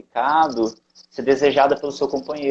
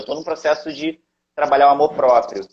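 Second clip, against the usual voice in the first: ordinary talk, nothing marked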